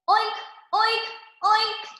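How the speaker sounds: high playful oinking; piggy oinks. A narrator's reading. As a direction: oink like a happy little pig. Pig talk in the story.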